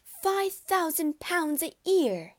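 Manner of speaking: high, loud, slow mother's crow of delight; mother gloating over a rich match, loud and high; gleeful cry. A narrator's reading. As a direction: shout the sum out, thrilled to bits. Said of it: "she cried".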